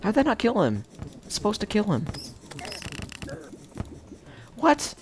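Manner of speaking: high outraged disbelief. Real, not acted. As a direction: squeak out your disbelief. Enemy survives attack in game.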